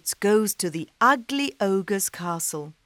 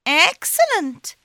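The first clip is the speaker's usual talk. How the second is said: cat voice; 11 semitones higher, 6 dB louder than usual. Acted. Cat pleased.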